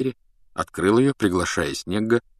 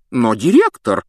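loud, raised protest; indignant; 4 semitones higher, 7 dB louder than usual. A narrator's reading. Matron protesting to the headmaster, indignant. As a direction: object indignantly. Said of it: "возмущённо заклокотала мадам Помфри".

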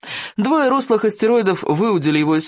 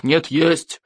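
insistent chieftain. A narrator's reading. Chieftain arguing back at the boy, firm contradiction. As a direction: counter firmly in the grown-up voice.

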